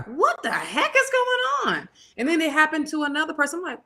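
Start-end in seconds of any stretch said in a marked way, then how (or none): 0.1-1.9 very high, comic outcry of disbelief; hammed-up high squeal of confusion; mock exasperated bafflement. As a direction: act out total bewilderment, pitch way up. Retells her bafflement at the blockage.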